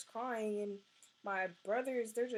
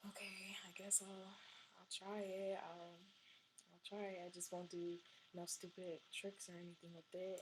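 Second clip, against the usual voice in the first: whispered to herself; 3 semitones lower, 13 dB quieter than usual. Retold or imitated. Reluctant inner voice at the ice.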